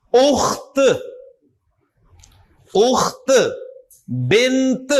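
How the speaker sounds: اخت and بنت with released final T; final T sounded. Shown as a demonstration. Teacher drilling long ta at word end, final T in اخت and بنت. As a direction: let the T ring out ending اخت and بنت. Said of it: "تو تا کی آواز آئے گی".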